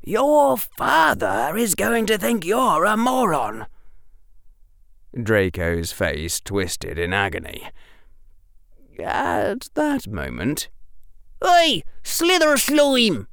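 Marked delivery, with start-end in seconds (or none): 0.0-3.7 urgent hiss, pitched high; high, tense rebuke. A narrator's reading. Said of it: "hissed Harry". 11.4-13.3 high, drawn-out taunting shout; high-pitched boyish challenge. A narrator's reading. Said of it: "shouted Ernie".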